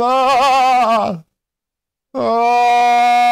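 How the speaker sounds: long, high "oh" yell; falling scream. Real, not acted. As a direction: let the yell swell as you plummet. Screams as character falls.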